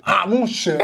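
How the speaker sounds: loud caricatured Haitian French; mock Haitian accent. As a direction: put on a Haitian lilt. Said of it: "What about the Haitians?".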